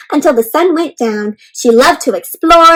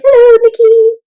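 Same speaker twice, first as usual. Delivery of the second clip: high, bright, louder hello; cheerful called-out hello to a storybook girl; greeting Nikki; 9 semitones higher, 5 dB louder than usual. Pretend. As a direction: wave and call hello to her.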